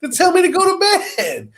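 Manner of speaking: high, laughing mock outrage; laughing indignation. Grown man answering ghost nun, laughing indignation. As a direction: protest through laughter, voice shooting up.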